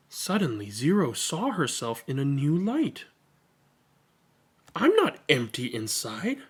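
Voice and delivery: put-on lively read-aloud for children; expressive picture-book reading; story narration. A narrator's reading. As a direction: read it to a room of kids, bright and animated.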